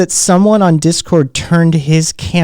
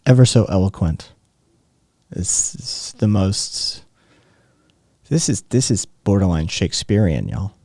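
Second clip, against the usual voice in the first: sarcastic; 10 semitones lower, 5 dB quieter than usual. Real, not acted. Mock praise of rant.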